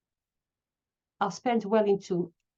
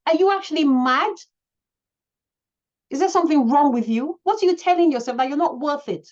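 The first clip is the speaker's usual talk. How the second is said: loud, higher self-scolding; shouting at herself; 5 semitones higher, 6 dB louder than usual. Real, not acted. Shouting at herself for undervaluing her work, loud. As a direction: yell at yourself to wake up. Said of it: "I need to shout at myself".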